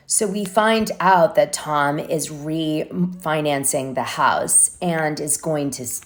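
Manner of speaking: usual speaking voice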